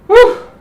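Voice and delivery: high, loud relieved whew; high exhale. Real, not acted. Coach after a hard set, high relieved whew. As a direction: blow out in relief.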